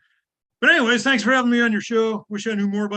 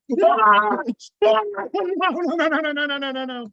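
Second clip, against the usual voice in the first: alarmed no; 7 semitones higher, 4 dB louder than usual. Real, not acted. Reacting to expired salad dressing.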